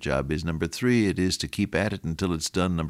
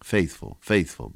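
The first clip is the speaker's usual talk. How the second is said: ordinary delivery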